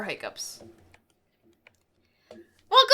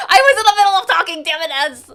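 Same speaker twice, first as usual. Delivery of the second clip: laughing; 4 semitones higher, 8 dB louder than usual. Real, not acted. Ads interrupt her mid-sentence.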